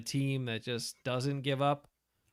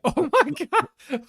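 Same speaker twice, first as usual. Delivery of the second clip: high, slow, laughing oh my God; real laugh through the words; laughing disbelief; 14 semitones higher, 9 dB louder, slower than usual. Real, not acted. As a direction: crack up in disbelief. Laughing at the hypocrisy.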